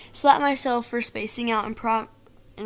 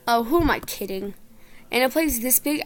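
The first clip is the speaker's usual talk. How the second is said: usual speaking voice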